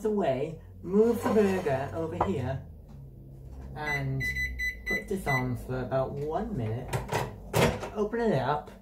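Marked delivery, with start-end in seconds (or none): none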